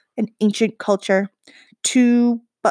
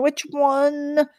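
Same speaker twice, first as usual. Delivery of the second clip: higher, anxious and hushed; panicked; 6 semitones higher than usual. A narrator's reading. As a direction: ask it in rising panic. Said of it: "Kull panicked".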